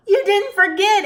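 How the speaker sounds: high, loud happy cry; excited yell. Acted. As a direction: call it out together. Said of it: "everyone yelled".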